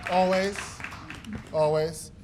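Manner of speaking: ordinary delivery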